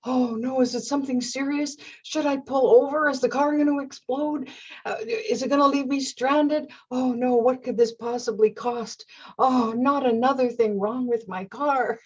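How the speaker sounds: high, worried rush of what-ifs; panicky inner thoughts. Retold or imitated. Driver worrying about her car, panicky and high. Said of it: "all these thoughts are flooding my mind".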